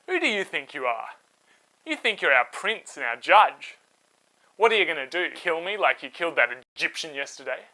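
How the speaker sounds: loud defiant challenge; angry retort. Acted. Israelite in the wrong shouting back at Moses, angry. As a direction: throw it back in his face, loud.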